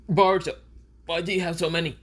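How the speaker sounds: high-pitched, bewildered question; bewildered Konohamaru. Acted. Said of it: "Konohamaru goes".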